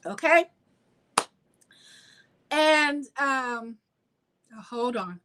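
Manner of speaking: just sipped tea, throat clearing; sipping hot tea. Real, not acted. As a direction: talk between sips of hot tea. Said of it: "Let me sip this tea".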